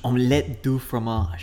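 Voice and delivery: slow, savoured French pronunciation; French accent. Shown as a demonstration. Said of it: "French accent".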